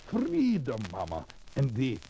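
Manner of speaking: put-on hopeful man in a European immigrant accent. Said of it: "With that accent?".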